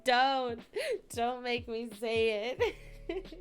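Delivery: pleading through laughter, slow; laughing plea. Real, not acted. Chat pushes her to say the word, she pleads through real laughter. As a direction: beg them off while cracking up.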